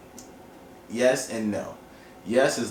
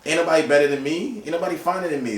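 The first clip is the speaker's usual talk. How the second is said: boastful; 7 semitones higher, 5 dB louder, faster than usual. Retold or imitated. Bragging as the ex.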